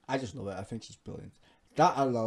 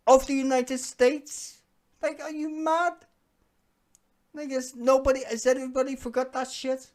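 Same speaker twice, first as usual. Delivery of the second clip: high, loud exasperated outburst; yelling in outrage; outraged; 13 semitones higher, 7 dB louder than usual. Real, not acted. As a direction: explode in disbelief. Outraged rant at Trump.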